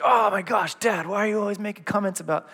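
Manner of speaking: mock the son's frustrated protest; imitating upset son. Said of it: "lost it at me".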